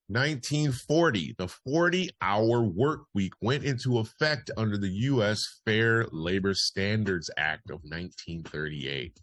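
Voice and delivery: punchy, projected history bulletin; news read. Acted. Host reads listeners this day in history, news read. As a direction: announce it like a radio newsreader.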